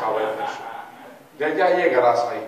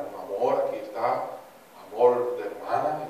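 ordinary talk

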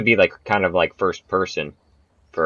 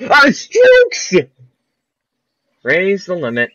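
shrill loud cry much higher up; loud high yelp; 13 semitones higher, 10 dB louder, slower than usual. Real, not acted. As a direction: yelp at the stroke limit in dismay. Hit stroke limit in game.

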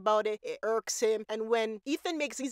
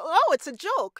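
mock dismissal; 7 semitones higher, 6 dB louder than usual. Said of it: "they're thinking".

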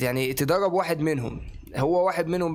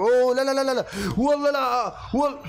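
dismayed shout; 7 semitones higher than usual. Real, not acted. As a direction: cry out as the chance slips away. Dismay at a game chance.

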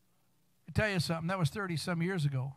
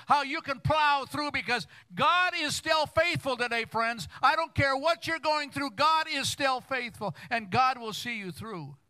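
full-force preaching; 10 semitones higher than usual. Acted. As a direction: preach it, rising and pushing hard.

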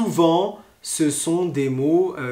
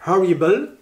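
"horrible" in French-accented English, H sounded; French speaker's English "horrible", ho-ri-bal; 3 semitones higher, 4 dB louder than usual. Shown as a demonstration. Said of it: "mon accent horrible".

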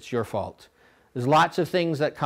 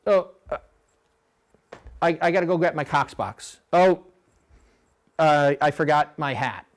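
disorganized coxswain; 3 semitones higher, 4 dB louder than usual. Retold or imitated. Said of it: "If you're not organized".

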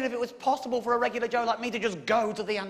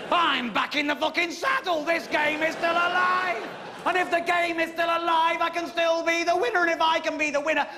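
triumphant; 4 semitones higher than usual. Real, not acted. Triumphant return to the game.

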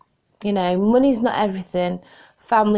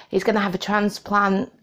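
ordinary talk, nothing marked

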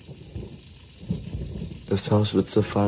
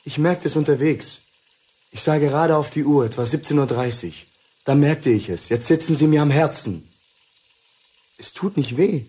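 higher, agitated, feverish telling; feverish, higher and raised; 5 semitones higher than usual. Acted. Husband tells Lucy he is dying too, high and feverish. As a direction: rush it out, high and strained.

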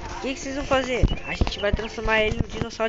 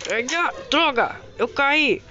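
yelping; 5 semitones higher, 5 dB louder, slower than usual. Acted. Villain falls in fight.

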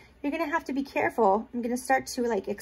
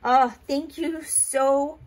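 normal talking voice

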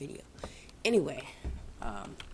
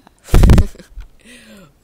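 loud spluttering laugh; burst of laughter; 5 semitones higher, 28 dB louder than usual. Real, not acted. Player amused by own wailing, loud spluttering laugh. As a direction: crack up at your own antics.

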